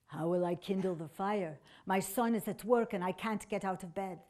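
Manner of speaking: soft, lightly voiced invalid; a hint of helpless old woman; sick woman. A narrator's reading. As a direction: complain quietly from the sickbed. Sick woman speaks from her bed.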